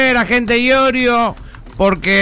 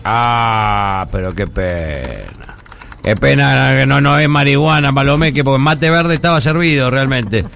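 mock disappointment; 6 semitones lower than usual. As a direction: sigh over the lost weed. Disappointed it isn't marijuana.

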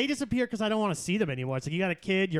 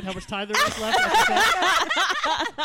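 laughing; 10 semitones higher, 5 dB louder, much slower than usual. Real, not acted. Joking incredulous question.

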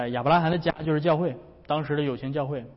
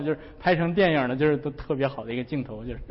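ordinary delivery

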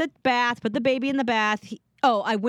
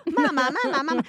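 higher little mama chant; Smurf-like toddler; 6 semitones higher than usual. Retold or imitated. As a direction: imitate a toddler repeating mama. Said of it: "It's like Smurf".

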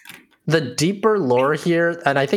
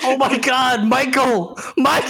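alarmed cry; 9 semitones higher than usual. Pretend. Character alarmed at Michael.

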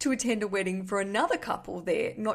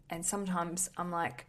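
ordinary talk, nothing marked

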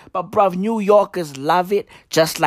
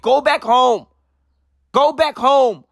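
ordinary delivery

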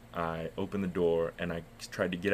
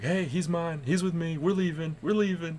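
high, loud, imitating an insistent wife; mock-frantic raised voice, pitched way up; wife's urgent call; 10 semitones higher, 5 dB louder than usual. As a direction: call out over the guards in your wife's voice. Wife intervening with guards, retold.

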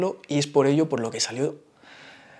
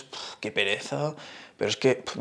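annoyed ugh; 5 semitones lower, 7 dB quieter than usual. Retold or imitated. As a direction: groan it like you can't be bothered. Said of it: "me da mucha pereza".

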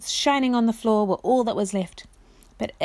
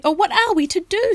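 high, distressed cry; desperate, at a loss; anxious plea; 10 semitones higher, 4 dB louder than usual. Acted. Jill to her friends, high anxious plea.

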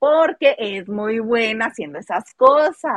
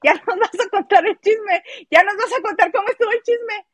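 mock gossip voice; 9 semitones higher than usual. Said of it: "llegó a donde estaba Niurka y le dice".

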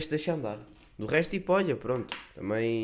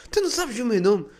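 indignant acquaintance; 12 semitones higher, 6 dB louder than usual. Retold or imitated. Guy confronts him about name.

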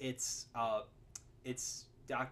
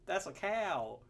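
mock Southern accent, higher pitched; dead Southern accent; 6 semitones higher, slower than usual. Movie fan imitating the new wife, dead Southern accent. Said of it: "her completely dead Southern accent".

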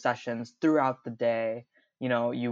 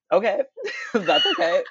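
higher, laughing reassurance; laughing parents; 8 semitones higher, 6 dB louder than usual. Retold or imitated. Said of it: "they're like".

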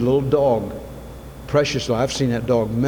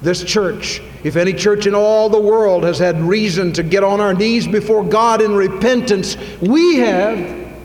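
impassioned preaching; 7 semitones higher, 4 dB louder than usual. Acted. Preacher calling the church to repentance, high impassioned shout. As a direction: cry it out, pleading for brokenness.

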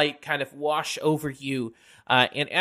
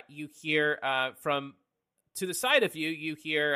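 normal talking voice

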